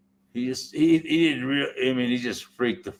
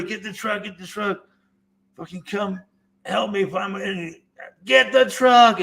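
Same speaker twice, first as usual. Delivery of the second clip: higher, urgent call; mock yell for the truck, pitched up; yelling at buddy; 8 semitones higher, 4 dB louder than usual. Telling his buddy to fetch the truck, urgent higher call. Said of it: "I kept yelling at my buddy".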